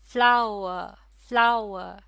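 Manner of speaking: "Flower" loud and unbroken, then repeated; word in one piece. Shown as a demonstration. Teacher showing a word that is not split, word in one piece. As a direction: give "flower" as one strong beat. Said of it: "पूरे लफ्ज़ को एक साथ ही अदा किया जाता है".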